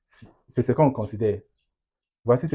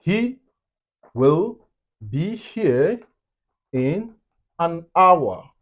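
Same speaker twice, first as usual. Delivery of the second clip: higher pitch, pauses between the words; slow word-by-word; 5 semitones higher, much slower than usual. Shown as a demonstration. Teacher giving an example sentence, slow and separated. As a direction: lay out the sentence slowly for learners.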